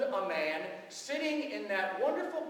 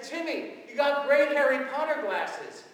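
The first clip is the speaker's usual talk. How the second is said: put-on bright, loud, delighted compliment to a child; hammed-up delight at the glasses; excited greeting; 5 semitones higher, 7 dB louder than usual. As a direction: cheer him on, louder and higher. Priest greets boy excitedly.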